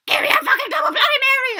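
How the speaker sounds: high, full-volume mock scream; loud, high-pitched drunken demand; raging drunk yell. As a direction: holler it like a raging drunk at the bar. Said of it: "rage into all the bars".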